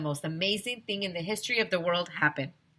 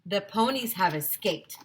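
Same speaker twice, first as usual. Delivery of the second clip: ordinary delivery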